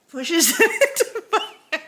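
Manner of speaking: can't keep it together; laughing. Real, not acted. Wife describing husband pushing vitamins in, laughing.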